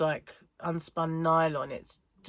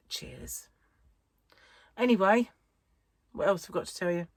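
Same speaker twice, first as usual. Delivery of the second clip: usual speaking voice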